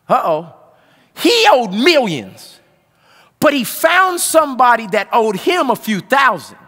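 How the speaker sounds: loud, climbing preacher's cry; preaching at full force. Acted. Pastor testifying to his congregation, preaching at full force. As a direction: preach it out, building to a roar.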